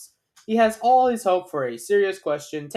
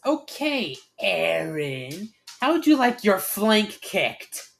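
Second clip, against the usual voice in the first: raised, slow threat; glaring challenge; 4 semitones higher, 5 dB louder, slower than usual. A narrator's reading. Rainbow glares at Aaron and threatens him, challenging. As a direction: start high, challenge him slowly. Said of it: "glares at Aaron and asks".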